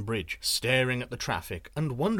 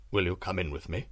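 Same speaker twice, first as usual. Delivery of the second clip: low looming threat; 7 semitones lower than usual. A narrator's reading. Sorcerer voiced in story.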